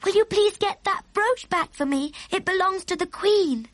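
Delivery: put-on high, bright little girl's cry of praise; excited cry. A narrator's reading. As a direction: call it out high like a delighted child. Sophie asks the giant.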